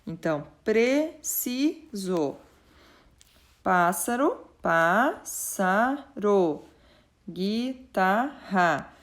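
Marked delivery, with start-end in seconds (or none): 0.6-2.3 "preciso" slowly as pre, ci, so; three separate beats on "preciso"; split into syllables. Shown as a demonstration. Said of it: "Na separação da palavra". 4.6-6.6 "pássaro" very slowly as pás, sa, ro; three drawn-out beats on "pássaro"; split into syllables. Shown as a demonstration. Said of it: "Na separação da palavra". 7.3-8.9 split into syllables. Shown as a demonstration. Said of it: "lembrando da separação de sílabas".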